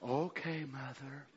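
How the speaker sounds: quiet, tender reply to mother; soft, changed voice. Retold or imitated. Lightly imitating Brother Branham gently answering his mother. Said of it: "he kinda changes his voice".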